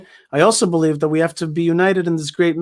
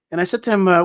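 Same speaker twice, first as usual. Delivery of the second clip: ordinary talk, nothing marked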